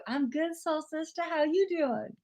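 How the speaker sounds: high, soft singsong reply; lightly put-on sisterly greeting; playful greeting back. Playfully answers viewer's greeting.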